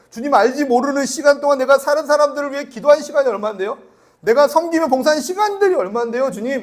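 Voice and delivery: loud, high, insistent demands; indignant protest. Acted. Believer lists years of service to the Lord, indignant protest.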